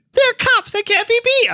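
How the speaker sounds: very high squeaky cartoon mouse; Mickey Mouse voice. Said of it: "I'm now Mickey Mouse".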